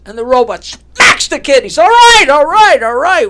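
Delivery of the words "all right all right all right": loud, high acted surrender; panicked giving in. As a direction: cry out to stop the blows, easing off. Kid confesses after robot smacks him.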